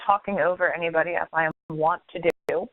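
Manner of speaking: usual speaking voice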